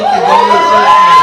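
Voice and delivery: whooping a victory cheer; mock cheer. Real, not acted. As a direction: cheer loud and high for the nutritionist. Cheering his nutritionist, mock shout.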